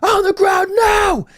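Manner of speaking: high, drawn-out yelled police order; retold cop shouting. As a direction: yell it slowly like a cop losing patience. Acting cops escalating to yelling.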